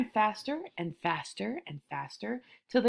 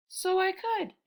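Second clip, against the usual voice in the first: lightly voiced tiger; 10 semitones higher, slower than usual. A narrator's reading. Tiger muses, higher voice.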